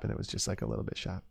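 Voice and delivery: softly whispered aside; whispering. Pretend. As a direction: drop to a whisper. Graded sharpness, trailing to whisper.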